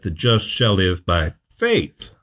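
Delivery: slow build to a separate, emphatic "faith"; stress on "faith". Shown as a demonstration. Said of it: "the emphasis is on faith".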